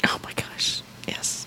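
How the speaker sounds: whispered, swooning agreement; hushed approval. Real, not acted. Co-host reacting to [name] love, whispered. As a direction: breathe it out in a whisper.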